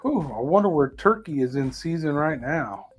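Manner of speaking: ordinary talk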